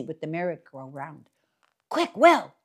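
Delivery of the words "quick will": high, urgent hissed-loud call. Acted. Jim urging Will to climb, urgent and high. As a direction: hurry him up the tree.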